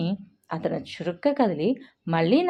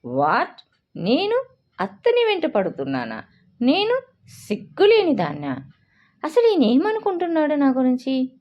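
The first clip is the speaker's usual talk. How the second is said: higher, incredulous, offended questions; indignant outrage; 8 semitones higher, 4 dB louder than usual. A narrator's reading. Tanvi fires back after Reyansh calls her shameless, indignant outrage. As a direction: spit back the insult in disbelief.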